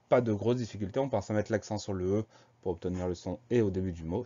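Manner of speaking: ordinary talk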